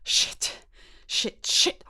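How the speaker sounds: hissed whispered curses; panicked swearing. Acted. Alone in her room, whispered panicked swearing.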